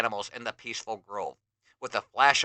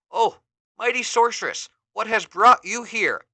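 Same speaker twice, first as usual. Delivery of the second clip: much higher, louder respectful greeting; respectful hero; 9 semitones higher, 6 dB louder than usual. Acted. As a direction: greet the sorceress with awe.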